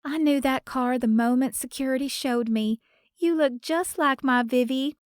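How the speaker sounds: a Southern lady moved to see her granddaughter; Southern grandmother voice. A narrator's reading. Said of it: "In a lyrical Southern accent".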